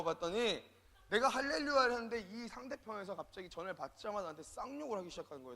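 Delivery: ordinary talk, nothing marked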